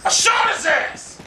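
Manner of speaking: real high-pitched outburst of rage; rage shout. Real, not acted. Gamer cursing the game over his shots, rage shout. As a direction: shout it in gaming fury.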